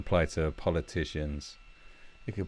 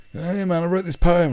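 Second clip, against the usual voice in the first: high, loud mock poet; pestering poet; 12 semitones higher, 10 dB louder than usual. Unwanted guest showing off a poem, mock eager. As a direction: pipe up as a needy poet, hammed-up.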